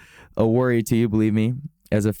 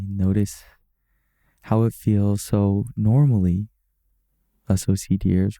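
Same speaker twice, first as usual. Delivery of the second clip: calm, even-pitched meditation guide; guided meditation. Acted. Guiding OCD beginners through breathing and body scan, calm meditation voice. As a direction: guide them gently, unhurried, with long settling pauses.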